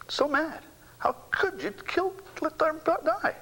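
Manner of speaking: high, breaking sob of grief; crying out. Real, not acted. Grieving father crying out at God over his son. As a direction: cry it out at God through tears.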